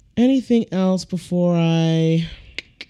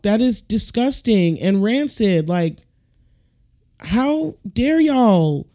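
normal talking voice

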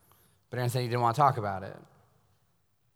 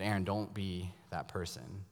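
tender plea; 5 semitones lower, 10 dB quieter, slower than usual. Retold or imitated. Father's earnest plea to son.

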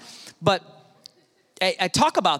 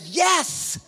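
put-on kid; 13 semitones higher than usual. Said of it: "kid's like".

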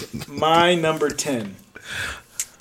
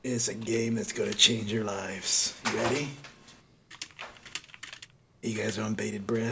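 DC villain voice; 3 semitones lower, 9 dB quieter than usual. Acted. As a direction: tease them like a comic book villain. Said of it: "not sound like a D C, uh, D C villain".

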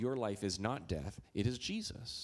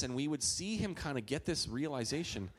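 ordinary delivery

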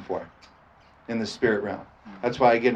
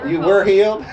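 higher, louder hillbilly twang; 7 semitones higher, 7 dB louder than usual. Shown as a demonstration. Preacher leads the hillbilly voice game with the congregation. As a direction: play the hillbilly for laughs. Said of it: "let's get it in our hillbilly voice".

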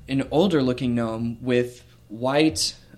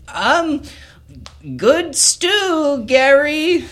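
high, loud squeaky gnome voice; nervous gnome; 6 dB louder, much slower than usual. Acted. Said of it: "Barry, pipes up and says".

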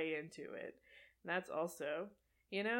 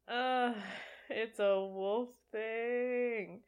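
slow deadpan repeat; 3 semitones higher, 5 dB louder, much slower than usual. Shown as a demonstration. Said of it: "I'm glad that is what they kept".

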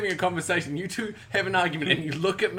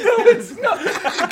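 laughing; 5 dB louder, much slower than usual. Real, not acted. Laughing protest.

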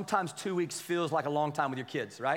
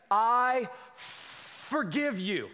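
reluctant forgiveness; 4 semitones higher, much slower than usual. Acted. Child forced to forgive.

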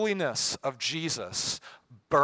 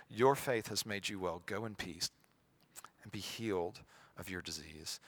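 soft, lowered, compassionate; tender; 4 semitones lower, 7 dB quieter than usual. Acted. Jesus sending the healed woman away, tender. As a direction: reassure gently, settling softer and lower. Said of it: "There's such tenderness in his voice".

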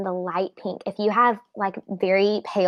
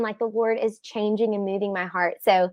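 ordinary talk

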